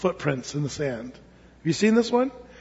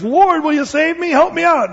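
high, louder desperate plea; pleading climber; 9 semitones higher, 4 dB louder than usual. Acted. Stranded climber begs God for rescue, pleading. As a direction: beg to be rescued, pitched high. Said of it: "And the guy says".

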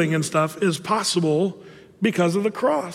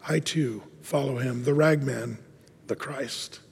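fading lower and softer; hushed ending; 4 semitones lower, 6 dB quieter than usual. Acted. Preacher closes the story softly with its reveal. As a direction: let the final words sink to a hush.

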